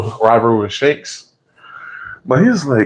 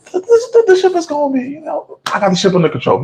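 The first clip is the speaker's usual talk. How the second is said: Buggy's squeaky boast. Retold or imitated. Buggy boasting to his partners.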